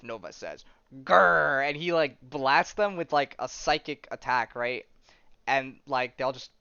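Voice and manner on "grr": mock growl. Retelling a character's growl.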